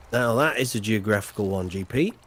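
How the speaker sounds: normal talking voice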